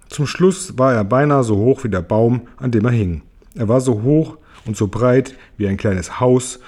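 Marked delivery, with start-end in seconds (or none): none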